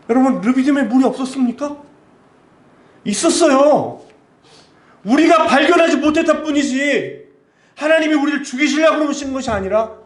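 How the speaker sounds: high-pitched, forceful pulpit cry; impassioned preaching. Real, not acted. Preacher correcting the congregation's doubt, impassioned preaching.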